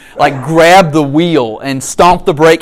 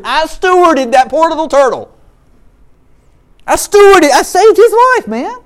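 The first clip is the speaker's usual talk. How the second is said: much higher, louder triumphant; mock heroic brag; proud boast; 10 semitones higher, 4 dB louder than usual. Real, not acted. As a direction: crow about saving it. Boasting, high, loud.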